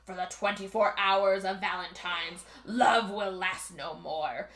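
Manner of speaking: mock death god, slowing to a drawn-out end; super spooky voice. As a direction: intone a doom-laden decree, hammed up. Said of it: "super spooky voice".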